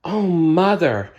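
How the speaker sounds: high, slow princess gasp; girlish princess voice. Acted. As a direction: breathe it like a wide-eyed young princess. Aurora voiced in read-aloud story.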